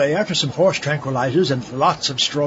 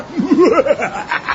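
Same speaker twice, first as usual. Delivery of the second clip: evil laugh; 10 semitones higher than usual. Acted. Mad scientist gloating.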